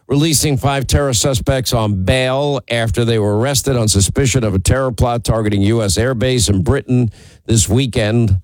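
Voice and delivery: ordinary talk, nothing marked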